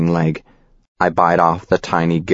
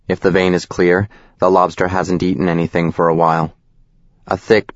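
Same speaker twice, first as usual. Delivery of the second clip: ordinary delivery, nothing marked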